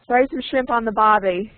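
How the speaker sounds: mock-Australian catchphrase; Australian accent. Shown as a demonstration. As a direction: put on an Aussie accent, dropping the r in "barbie". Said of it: "have you heard an Australian accent?".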